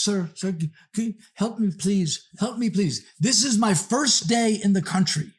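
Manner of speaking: frantic stranger begging for help in shaky English; mock broken English. Imitating the frantic woman begging him for help in broken English. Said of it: "she's speaking broken English".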